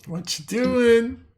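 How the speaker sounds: drawn-out high questioning; high slow NPC voice. Acted.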